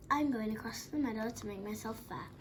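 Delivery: ordinary delivery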